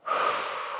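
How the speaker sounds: long breathy sigh out; heavy sigh. Real, not acted. Moment of being choked up.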